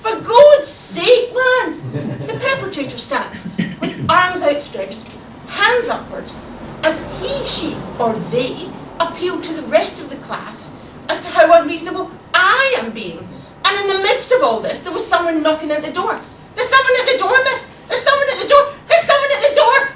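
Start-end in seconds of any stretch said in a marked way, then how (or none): 0.0-2.3 loud, high, drawn-out protest; hammed-up outraged wail; indignant cry. A narrator's reading. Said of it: "always accompanied by cries of". 16.5-19.9 high, loud, insistent calling; excited classroom alarm, repeated; clamouring pupils. Acted. Pupils clamouring to tell teacher.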